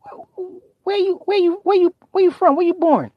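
very high, stammering questions; flustered dad. Retold or imitated. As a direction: stumble over the question. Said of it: "I'm like".

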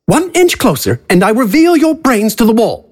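high theatrical villain, smug; a smug, high showman announcing. Acted. AJ threatens, character voice.

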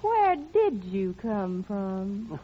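very slow, drawn-out question, pitched low; slow and coy. Acted. Singer coaxing the evasive stranger, slow and coy. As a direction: stretch each word out coyly.

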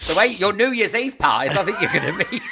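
high laughter through the words; laughing. Real, not acted. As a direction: laugh while talking. Both laughing at teasing.

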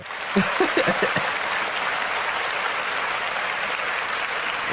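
high burst of laughter; real laughing. Real, not acted. Host amused by the funny song, laughing.

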